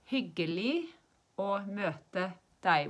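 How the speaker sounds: slow model phrase with "deg" ending without a G; silent final G. Shown as a demonstration. Teacher showing nice to meet you, "deg" said slowly without its G. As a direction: drop the G at the end of "deg". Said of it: "We don't say the G at the end".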